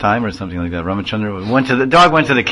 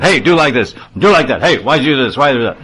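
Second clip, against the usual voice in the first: louder bossy scolding, mock bark; 3 semitones higher, 4 dB louder than usual. Proud mahant barking orders at ashram people, mock scolding. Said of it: "I was always barking at everyone".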